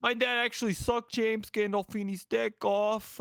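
put-on shitty Northeastern accent. Son boasting about his dad, hammed-up Northeastern accent. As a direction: say it as a bad New Yorker. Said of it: "of this Northeastern person".

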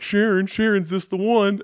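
high, drawn-out imitation; cartoon character quote. Friend quoting a cartoon dad mid-fight, mock calling out. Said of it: "It was like".

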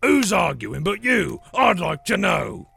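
high, loud troll challenge; indignant troll shout. Acted. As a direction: demand it loudly, spoiling for a fight. Trolls quarrel.

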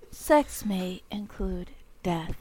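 cheerful ad with eerie menace. Pretend. Mock drug warning.

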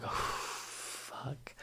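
whispered curse on a long breath; acted stage-fright dread; dreading exhale. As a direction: breathe out hard, then curse under it. Retelling dread as he's introduced.

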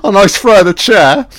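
excited shout. Real, not acted. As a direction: cheer the chair throw. Reacting to a fight.

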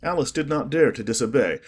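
usual speaking voice

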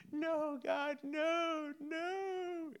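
high, soft, drawn-out no's; mock wailing plea. Believer begging God not to end a season, mock wail. Pleading with God against new chapter.

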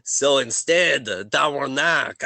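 put-on French Canadian accent. Game master swaps the pirate for French, mock French accent. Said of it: "I will do the French accent".

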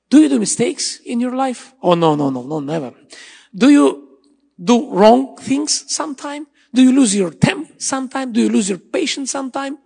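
fervent sermon challenge; preaching at full force. Acted. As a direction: press the crowd with each question. Preacher challenges congregation with questions.

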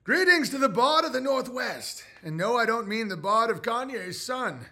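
high, loud grand bard. Pretend. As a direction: proclaim it like a bard. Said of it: "Bard voice".

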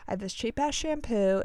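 ordinary talk, nothing marked

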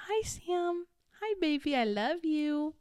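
sweet singsong cooing, drawn out at the end. Real, not acted. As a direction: coo lovingly in a high, gentle voice. Cooing to Sam, who watches.

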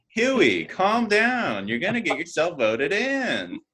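high, drawn-out playful plea; high-pitched exasperated coaxing; mock pleading. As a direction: call out as if talking someone down. Voicing housemates pleading with Huey.

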